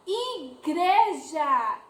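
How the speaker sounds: Igreja stretched very slowly, syllable by syllable; drawn-out syllables. Shown as a demonstration. Teacher to children, sounding out Igreja very slowly. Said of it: "teacher drawing out word".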